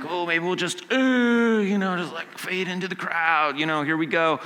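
a hint of awkward dodging, stretched "you know"; nervous disciples. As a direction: play the shifty disciple hoping not to be noticed. Voicing nervous disciples avoiding Jesus.

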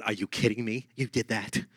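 lightly voiced Valley Girl disbelief, low and soft. Preacher imitating scoffing grandkids, a hint of Valley Girl. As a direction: toss it off as a mild mock Valley Girl. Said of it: "why they'd be a Valley Girl".

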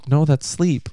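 normal talking voice

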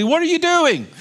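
pointed challenge; emphatic question. Shown as a demonstration. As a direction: fire the question up high. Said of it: "God is asking that question".

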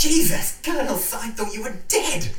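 high, loud startled cry; shocked. Acted. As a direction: gasp it out in disbelief. Shock at Colonel reveal.

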